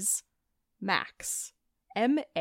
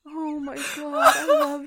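laughing tears; 4 semitones higher, 8 dB louder than usual. Real, not acted. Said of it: "I'm crying".

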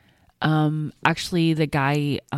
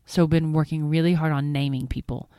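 normal talking voice

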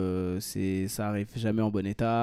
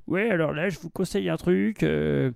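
higher-pitched parody of a clueless shop seller; mock know-it-all salesman; 7 semitones higher, 4 dB louder than usual. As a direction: put on a salesman pretending to know his stuff, pitched up. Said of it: "t'as le vendeur qui". (vendeur faussement connaisseur, voix plus aiguë)

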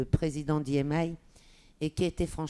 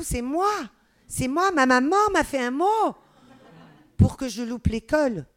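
indignant protest; 7 semitones higher, 7 dB louder than usual. Retold or imitated. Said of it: "Je dis".

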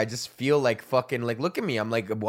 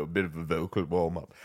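low warm-up voice; 10 semitones lower, 6 dB quieter than usual. Shown as a demonstration. Warming up his voice for scripts, in a low soft rumble. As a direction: drop the line much lower and softer.

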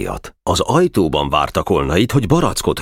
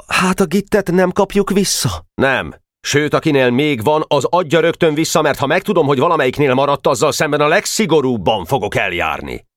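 a higher pitched, lightly voiced exchange; schoolboy and teacher; 10 semitones higher than usual. Teacher warning the boys to hand back the putty. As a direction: threaten strict punishment.

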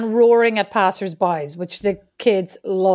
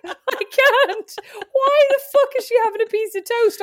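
much higher, frantic disbelief; frantic rant; 12 semitones higher than usual. Retold or imitated. Frantic retold inner rant.

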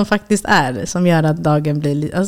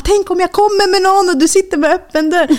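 high-pitched mock complaint; indignant father imitation; 13 semitones higher than usual. Daughter imitates dad's complaint about open toilet door, high mock indignation. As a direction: send up an appalled dad, pitched way up.

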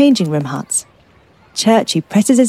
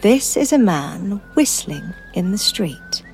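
guided meditation; 13 dB quieter than usual. Acted. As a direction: guide them gently into calm. Names sounds in the meditation.

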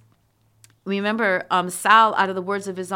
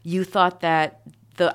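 usual speaking voice

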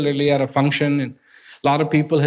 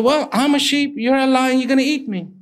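a little cub, voice raised high, pleading; high timid cub voice; 8 semitones higher than usual. Acted. Cub telling the lion it is only a sheep, high and timid. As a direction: pitch it up like a small scared cub.

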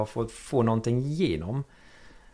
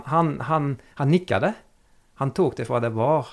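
ordinary talk, nothing marked

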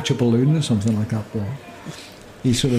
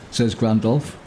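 normal talking voice

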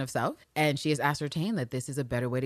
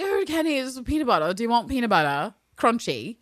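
high, bright falsely cheerful offer; mock sweet hostess; 5 semitones higher, 4 dB louder than usual. Carolyn cutting Kenny off, mock sweet deflection. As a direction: chirp it sweetly to change the subject. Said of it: "Carolyn's like".

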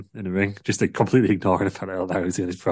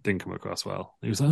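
ordinary talk

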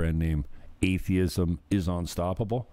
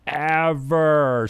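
normal talking voice

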